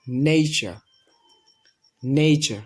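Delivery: নেচার as "NEI-cha", long gliding first vowel; correct pronunciation. Shown as a demonstration. Teacher modelling the correct form, নেচার as "nei-cha". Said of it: "এটা সঠিক উচ্চারণ হচ্ছে".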